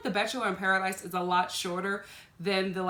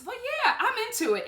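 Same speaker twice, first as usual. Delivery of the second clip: much higher, bright-pitched yes; excited approval; 12 semitones higher than usual. Real, not acted. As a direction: squeal your approval. Enthusing about Grocery Store Joe.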